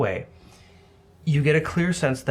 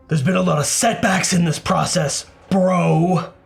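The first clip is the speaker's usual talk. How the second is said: loud, higher villain calling from afar; echoing villain voice; 5 semitones higher, 5 dB louder than usual. Acted. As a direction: call back like an unseen villain. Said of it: "hear echoing back".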